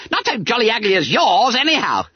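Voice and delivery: high, squeaky, cheeky retort; indignant yell. A narrator's reading. Bunter insults Quelch's face in return, yelling indignantly. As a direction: fling it back at the master. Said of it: "yelled the indignant owl".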